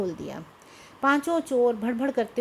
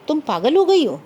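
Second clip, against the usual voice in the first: louder, exasperated disbelief in a story reading; sharp, raised-voice rebuke; incredulous outburst; 3 semitones higher, 9 dB louder than usual. A narrator's reading. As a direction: burst out at her as if she's lost her mind. Acted quarrel, frightened husband rebukes wife.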